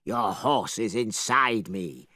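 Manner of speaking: a hint of a gloating dragon. Dragon answering.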